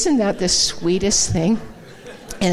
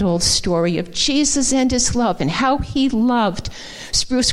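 impassioned preaching; 6 semitones higher than usual. Acted. Pastor proclaiming God's care to the congregation, fervent. Preaches God knows our stories.

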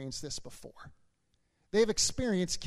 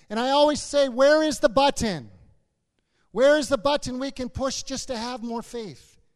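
insistent, raised pulpit plea; impassioned preaching; 6 semitones higher, 8 dB louder than usual. Real, not acted. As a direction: preach it as an exasperated appeal. Preacher voices congregation's plea.

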